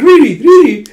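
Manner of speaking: very high, loud acted squawk; incredulous outburst. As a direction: shriek the question in comic disbelief.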